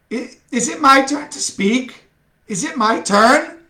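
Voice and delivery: high eager question; anxious to speak next; eager actor. Acted. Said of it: "asked Francis".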